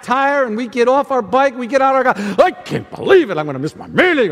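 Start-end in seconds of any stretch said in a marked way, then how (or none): 2.2-4.3 grumbling complaint. Acted. Preacher acts out flat tire complaint.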